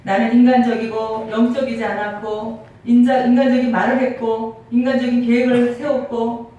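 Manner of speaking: loud, driving sermon climax; preaching at full force. Acted. Pastor confessing to the congregation, loud impassioned preaching.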